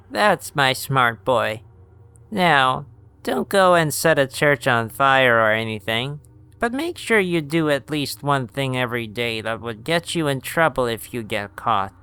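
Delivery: a hint of a doting grandma. Grams praises Dion.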